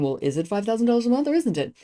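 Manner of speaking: quick, voice climbing up to "month" like a question; mock question intonation. Shown as a demonstration. Coach mimicking how not to close a sale, mock questioning lilt. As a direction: rush it, lifting "month" high as if unsure of the price. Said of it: "go up at the end like it's a question".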